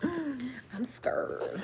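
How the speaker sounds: a laughing whispered I'm scared; whispered mock fear. Joking to chat about cooties, whispered.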